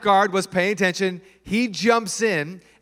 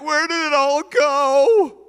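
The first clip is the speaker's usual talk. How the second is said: bewildered complaint; 8 semitones higher, 5 dB louder, slower than usual. Retold or imitated. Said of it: "And you're like".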